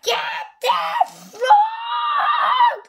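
very high, drawn-out shouted command; loud, screaming storybook king; furious king. A narrator's reading. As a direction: cry out in royal rage. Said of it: "The king was furious".

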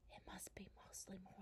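very soft, quick whisper. A narrator's reading. Laura to Mary after lying awake a long time, whispering. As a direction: whisper it in the dark. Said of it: "Laura whispered".

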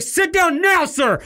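high, loud barked order over the PA; stern stewardess command. Retold or imitated. Flight attendant ordering the standing passenger to sit, loud and high. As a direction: snap it like a flight attendant on the mic.

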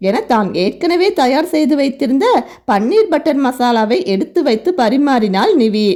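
plain reading voice

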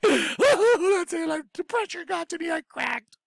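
high, hammed-up voice of a man who cracked; mock cracked self. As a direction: squeak it like you have lost it. Mocking himself as cracked.

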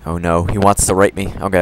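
usual speaking voice

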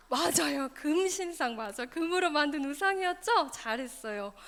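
ordinary delivery, nothing marked

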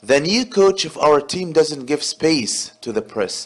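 reading the sentence out slowly and carefully; slow exam read. Shown as a demonstration. Teacher to students, reading the exam sentence slowly. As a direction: read it like a test item for the class.

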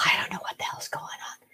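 soft whisper of confusion; barely breathed, lost and dazed. Real, not acted. Confused waking from coma.

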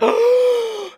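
high-pitched, excited gasp; big thrilled intake of breath; sharp gasp. Real, not acted. Joy at the enchantment working.

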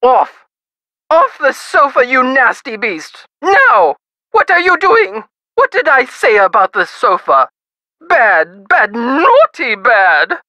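indignant scolding, like correcting a pet; haughty lady snapping orders at a misbehaver. Acted. Wife ordering her husband off the sofa, scolding.

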